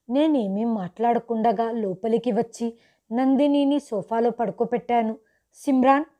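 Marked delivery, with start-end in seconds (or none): 5.5-6.0 a father's alarmed question, higher and louder; the father calls out in sudden worry; anxious father, a lightly voiced alarm; worried father. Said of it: "నాన్న గొంతు కంగారుగా ఉంది".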